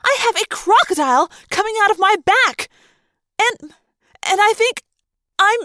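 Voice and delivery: high-pitched panicky outburst, breaking into halting fragments; frantic, losing the sentence as it goes; panicked. Acted. Girl with a crocodile coming out her back, panicked.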